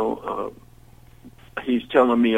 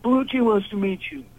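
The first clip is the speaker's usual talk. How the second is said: higher, insistent voice for the dealer; pushy dealer; 10 semitones higher than usual. Retold or imitated. Dealer interrupting the singer mid-song, higher and insistent. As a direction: lean in and nag him. Dealer pestering during the set.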